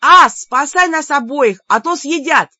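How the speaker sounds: high, loud yell for rescue; loud, high alarm; urgent call for help. A narrator's reading. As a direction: shout to a brother in the next room. Героиня зовёт брата на помощь.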